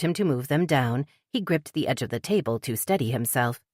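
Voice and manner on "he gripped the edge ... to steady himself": ordinary delivery, nothing marked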